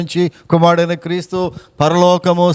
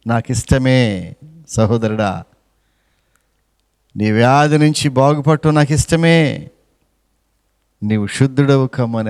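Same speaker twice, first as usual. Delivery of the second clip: gentle reassurance; 5 semitones lower than usual. Acted. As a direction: soothe the sick man kindly. Jesus answering the leper.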